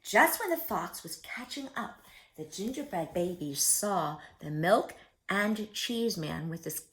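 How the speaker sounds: usual speaking voice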